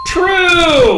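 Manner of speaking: high, loud happy call; excited. Real, not acted. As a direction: announce it with a cheer. Announcing the answer.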